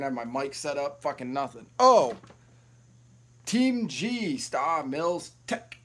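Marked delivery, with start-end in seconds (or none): 1.8-5.8 loud, high "Oh!" then drawn-out name; excited shout-out. Real, not acted. Streamer spots a viewer joining, excited shout-out. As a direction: call out a welcome, stretching the name.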